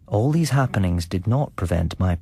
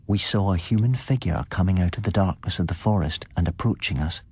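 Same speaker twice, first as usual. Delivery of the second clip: plain reading voice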